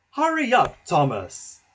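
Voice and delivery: loud, sharp, impatient call; cross. A narrator's reading. Gordon crossly hurrying Thomas along. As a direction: snap at him to get moving. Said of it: "said Gordon crossly".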